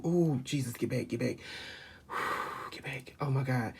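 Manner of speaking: fighting back real tears, softly; overcome. Real, not acted. Reader overwhelmed by the father's message, softly sighing. Overwhelmed by emotion mid-reading.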